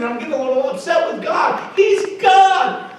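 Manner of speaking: loud, high, exasperated outburst; retold angry cry of a man losing patience; frustrated shouting at God. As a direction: shout it up at heaven, fed up. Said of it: "I'm getting so frustrated".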